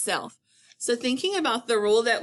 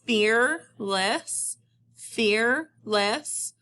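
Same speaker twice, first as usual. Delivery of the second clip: FEARLESS split slowly into "fear" and "less"; syllable by syllable; much slower than usual. Shown as a demonstration. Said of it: "I am hearing two syllables".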